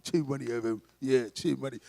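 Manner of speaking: low geezer muttering complaint; grumbling geezer. Acted. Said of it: "like, geezers".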